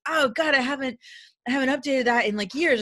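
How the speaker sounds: sheepish oh God, a little loud; retold flustered admission; mock embarrassed surprise. Client's embarrassed reply retold.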